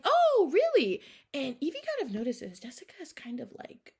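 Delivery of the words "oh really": much higher and louder, a squealed oh really; high surprised squeal. Retold or imitated. Jessica reacting to news about Avery, high loud surprise. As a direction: shoot the pitch up in mock shock.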